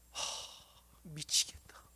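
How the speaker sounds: low, soft whisper of despair; anguished whisper. Acted. Peter whispering to himself in anguish. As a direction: mutter it to yourself in quiet torment.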